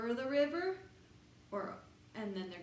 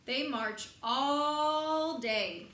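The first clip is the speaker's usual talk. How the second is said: slow tired march; 2 semitones higher, much slower than usual. Acted.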